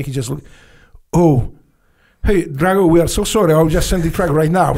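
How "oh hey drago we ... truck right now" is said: apologetic officer. Retold or imitated.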